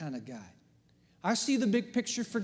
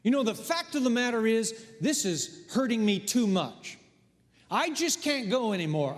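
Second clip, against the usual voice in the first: ordinary delivery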